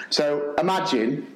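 ordinary delivery

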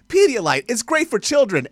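high, punchy ad imitation; commercial announcer. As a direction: pitch it like a cheery product spot. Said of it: "It was a random commercial".

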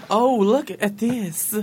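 slow, drawn-out fortune teller voice; Romani accent. Pretend. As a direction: gaze into the crystal ball.

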